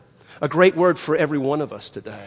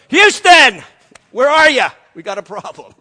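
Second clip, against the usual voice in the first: loud, higher mock panic shout that drops off at the end; panicked yell; 4 semitones higher, 10 dB louder than usual. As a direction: yell for help, then sag. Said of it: "I'd have gone".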